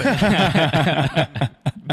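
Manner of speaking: hearty burst of laughter; laughing hard at the memory; loud laugh. Real, not acted. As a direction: laugh at yourself. Laughing at his own story.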